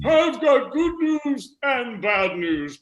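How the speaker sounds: slow, drawn-out centenarian voice; very old man. Acted. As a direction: take it slowly in a put-on old man's voice. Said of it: "The hundred and two-year-old man said to his wife".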